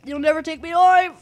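loud, quick mock-heroic cry; hammed-up last stand, rattled off loudly; mock defiance. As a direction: shout playful defiance at an enemy. Mock defiance of the game border.

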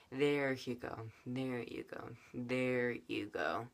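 soft, slowing reassurance; soothing nurse. Retold or imitated. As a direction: imitate a nurse gently settling a patient. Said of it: "slowly starting to calm down".

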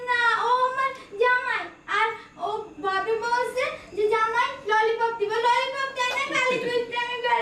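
put-on childlike crying protest; tearful complaint. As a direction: complain tearfully about being teased. Girl complains when caught.

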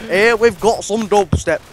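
hammed-up West Country farmer; farmer accent. Friend clowning about dubstep, mock farmer accent. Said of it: "sound like a farmer".

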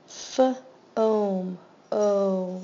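loud and segmented; sounding out foam. Shown as a demonstration. Teacher sounding out a word for students, loud and segmented. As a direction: split foam into F, ohm, O, loud. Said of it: "long O".

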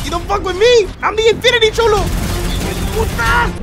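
high, loud battle boast; swaggering boast. Pretend. Sword wielder boasting to everyone he fights, swaggering. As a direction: proclaim it like a conquering champion.

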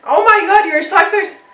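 ordinary talk

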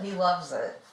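ordinary delivery

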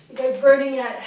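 loud coach call to the class. Real, not acted. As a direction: shout it across the gym.

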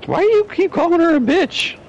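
very high, squeaky protest; mock outrage. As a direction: squeal the question in playful indignation. Mock outrage at friend's name-calling.

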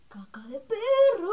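slow, high, loud put-on voice; Daniela. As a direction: drag it out in a silly mocking voice. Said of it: "usar la voz de Daniela así".

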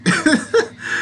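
high, loud laughing burst; loud burst of laughter. Real, not acted. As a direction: crack up loudly. Laughing at own story.

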